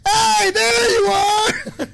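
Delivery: very high, slow shout from far above; distant man shouting. Retold or imitated. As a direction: call down from the upper level. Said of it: "I hear a guy on the second level go".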